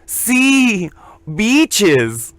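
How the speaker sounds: hammed-up Mexican accent. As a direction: correct him in a Mexican accent. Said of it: "And he goes".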